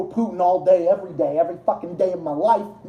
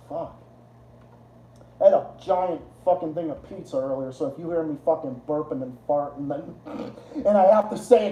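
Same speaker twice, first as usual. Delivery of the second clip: ordinary talk, nothing marked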